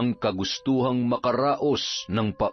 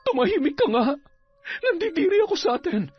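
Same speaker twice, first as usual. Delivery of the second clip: much higher, fast disgusted woman; much faster than usual. Acted. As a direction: spit out the shame. Ellie disgusted.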